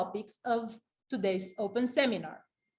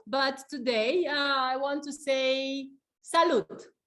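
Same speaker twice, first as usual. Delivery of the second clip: ordinary delivery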